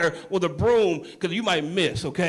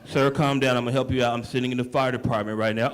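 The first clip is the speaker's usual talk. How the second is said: low, disbelieving operator voice; a deep-voiced dispatcher who can't believe what he heard; 911 operator; 8 semitones lower than usual. Operator reassures.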